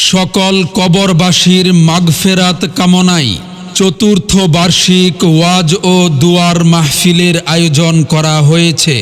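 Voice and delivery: normal talking voice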